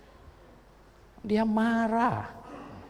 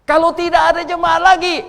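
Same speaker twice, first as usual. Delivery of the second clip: high, loud preaching burst; sudden shouted sermon line; loud outburst; 10 semitones higher, 14 dB louder than usual. Real, not acted. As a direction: throw this line out with full force.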